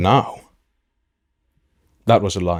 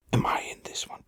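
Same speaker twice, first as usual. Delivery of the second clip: breathless whisper, nearly silent; sneaky whisper to himself; whispered aside; 10 dB quieter than usual. Shown as a demonstration. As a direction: hush it like a secret. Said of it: "retake of the same line".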